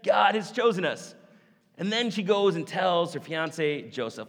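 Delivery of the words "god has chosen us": higher, louder giddy thought; bubbling over with joy; excited Mary. Retold or imitated. Mary eager to tell Joseph the news, excited. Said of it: "she's thinking to herself".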